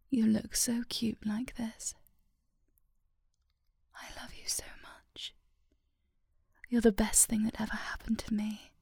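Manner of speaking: normal talking voice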